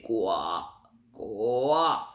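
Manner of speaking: deep toad croak. Shown as a demonstration. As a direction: croak it way down low and slow. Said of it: "avec une voix de crapaud".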